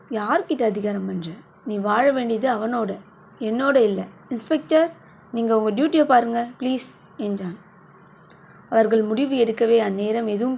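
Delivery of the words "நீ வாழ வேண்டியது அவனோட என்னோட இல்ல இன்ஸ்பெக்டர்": ordinary delivery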